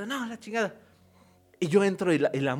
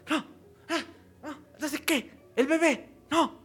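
higher, softer mumbled pleading; sleep-talking sister; 10 semitones higher, 4 dB quieter, slower than usual. Retold or imitated. Brother imitating sister talking in her sleep, high soft mock mumbling. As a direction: mumble like someone lost in a nightmare. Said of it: "la vi a ella acostada así".

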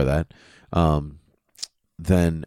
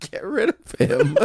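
laughing; 9 semitones higher, slower than usual. Pretend. Repeats villain line laughing.

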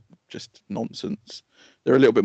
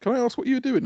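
lightly voiced policeman, pitched higher; imitating the police officer; 13 semitones higher, faster than usual. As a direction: play the cop, polite but suspicious. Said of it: "he was like".

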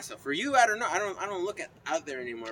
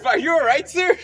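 mock-concerned shout; 12 semitones higher, 15 dB louder than usual. Mock concern, loud and high.

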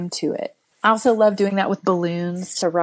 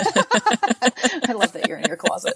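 laughing; 4 semitones higher than usual. Real, not acted. Laughing at friend's closet confession.

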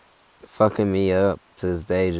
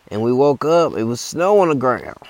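put-on high, loud old hiker bragging; hammed-up high old man; old guy voice; 6 semitones higher, 6 dB louder than usual. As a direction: put on the old-timer boasting. Old guy voiced in hiking story.

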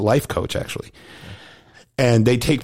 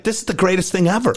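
excited; 7 semitones higher than usual. Retold or imitated. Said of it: "He's like".

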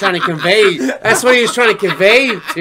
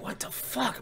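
whispered disbelief; 7 semitones higher, 15 dB quieter than usual. Retold or imitated. Muttered reaction.

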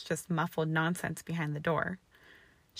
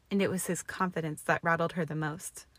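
ordinary delivery, nothing marked

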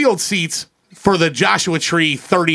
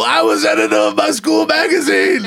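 [name] impression; 3 semitones higher than usual. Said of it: "you just hear [name] go".

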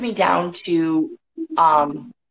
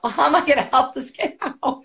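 laughing it out, higher; 7 semitones higher than usual. Retold or imitated. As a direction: laugh at your own rash promise. Laughing at her own rash promise.

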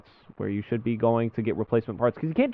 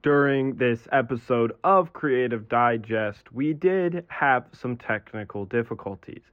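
announcer read; slower than usual. Acted. Recorded intro disclaimer read.

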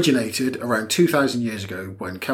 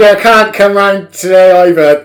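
loud, high put-on caller; hammed-up excuse down the phone; mock phone excuse; 4 semitones higher, 11 dB louder than usual. Acts out lying phone call.

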